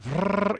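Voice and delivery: engine noise. Retold or imitated. Imitating engine start.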